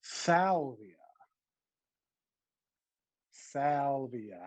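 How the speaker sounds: salvia drawn out slowly, SAL-vee-uh; salvia, slow teaching pace, SAL-vee-uh; slow model word. Shown as a demonstration. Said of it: "repeat after each other".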